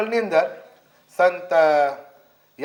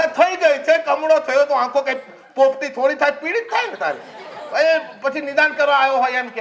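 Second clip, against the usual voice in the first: normal talking voice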